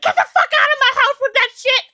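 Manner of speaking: very high, loud mock yell; mock furious yell. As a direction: throw her out of the kitchen in a hammed-up shout. Friend yelling her out.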